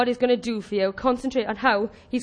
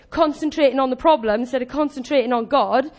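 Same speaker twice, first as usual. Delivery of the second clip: usual speaking voice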